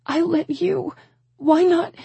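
very high, tearful cry; sobbing words. A narrator's reading. Woman pounding his chest, sobbing. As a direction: wail it through tears. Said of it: "becoming sobs".

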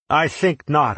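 loud, high retort; too loud. A narrator's reading. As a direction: blurt it out too loudly. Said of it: "I said rather too loudly".

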